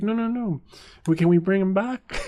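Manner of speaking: high, loud plea with laughter; imitated squealing objection; dismayed refusal. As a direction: play an aghast wife begging to undo it. Wife wants dog returned.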